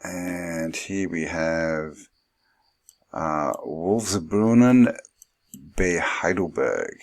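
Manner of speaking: slow, hammed-up German accent on the place name; mock German accent. A joking German accent while reading a Heidelberg photo caption. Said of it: "How was that for my German accent?".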